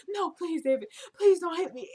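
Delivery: high, pleading fake panic; mock pleading. Woman staging an attack, begging David in high mock panic. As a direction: beg like a staged victim, hammed-up. Faking an assault in the elevator.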